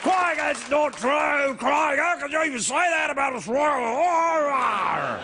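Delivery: put-on loud, slow, indignant Australian accent shout; irate Australian. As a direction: rant like an offended Australian. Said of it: "me being an irate Australian".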